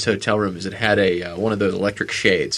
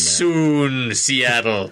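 mock villain; much slower than usual. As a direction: intone it like a scheming cartoon villain. Gloating villain at the hotel window.

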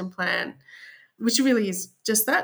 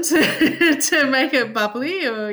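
laughing; 6 semitones higher, 7 dB louder, slower than usual. Real, not acted. Jokes about bubbly wine.

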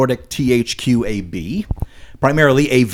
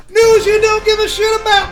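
segment announcer. Acted. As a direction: call out the segment title like a game show host. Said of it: "announces the segment title".

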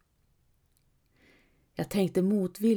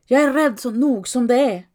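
sharp, loud angry snap at him; hissing snap; 5 semitones higher, 7 dB louder than usual. A narrator's reading. Wife snapping at her husband while the village burns, angry and loud. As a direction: spit the words out at him, louder. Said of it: "fräste jag till honom".

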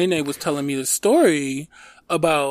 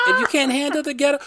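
higher put-on grandma voice with a laugh; laughing through a granny impression; Nene's voice; 11 semitones higher than usual. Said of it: "That's what Nene used to say".

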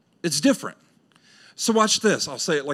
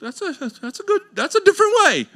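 much higher, squeaky stunned voice; mock reluctant believer; 11 semitones higher, faster than usual. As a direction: play the startled believer for laughs. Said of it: "It's like".

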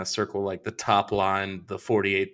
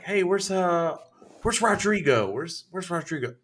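imitated salesman, pitched up, asking around; higher-pitched call for a missing colleague; calling for someone; 7 semitones higher than usual.

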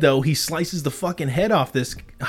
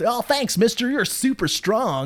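put-on wholesome 1950s kid; 6 semitones higher than usual. Voicing the two rescued boys.

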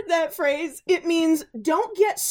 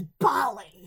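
lower, disgusted dad's word; imitated disgust; 6 semitones lower, 3 dB quieter than usual. Quoting Billy's dad on ballet, mock disgusted. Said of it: "the disgust with which he says".

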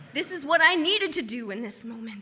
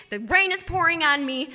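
ordinary talk, nothing marked

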